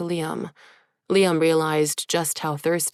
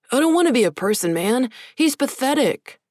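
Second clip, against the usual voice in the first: protesting; 8 semitones higher, 4 dB louder than usual. Acted. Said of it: "Mitchell protested".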